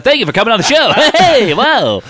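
high, loud, hammed-up host cutting things off; backtracking talk show host. Host ending the show in a panic, high hammed-up backtracking. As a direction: hurry a guest off air in a high, loud voice. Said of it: "conservative talk show host".

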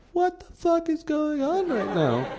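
very high, strained mock bewilderment; baffled squeal. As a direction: squeak it out in comic disbelief. Acting out their baffled looks.

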